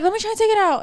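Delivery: high-pitched, anxious offer; flustered stranger imitation. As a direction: imitate an apologizer offering help. Quoting the flustered apologizer.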